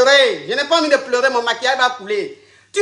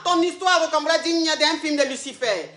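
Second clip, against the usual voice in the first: ordinary talk, nothing marked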